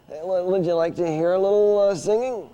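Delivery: high, eager dummy; puppet voice. Acted. Dummy Orson asking the audience, high eager voice. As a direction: offer it as the keen puppet.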